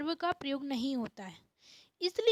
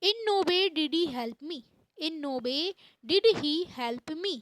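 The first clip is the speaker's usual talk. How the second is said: slow, deliberate example; spaced English phrase; slow model sentence; much slower than usual. Shown as a demonstration. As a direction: spell the sentence out for the class. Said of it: "model sentence, slow".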